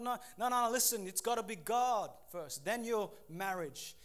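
ordinary talk, nothing marked

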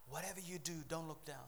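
soft, restrained warning; hushed closing refrain; quiet reminder. Real, not acted. Quiet reminder before God's voice.

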